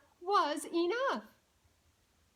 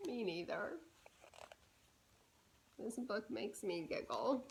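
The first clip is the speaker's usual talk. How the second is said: quiet, lower confiding aside; soft aside; 5 semitones lower, 8 dB quieter than usual. Shown as a demonstration. Reader confiding to the child she giggles too. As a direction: drop your voice and confide.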